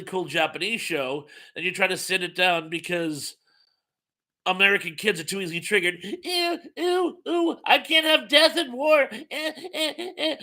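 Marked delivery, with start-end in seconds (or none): 6.0-10.4 much higher, mocking squeamish child; mock whiny kid. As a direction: parody a squeamish kid who can't handle war. Mocking squeamish American kids.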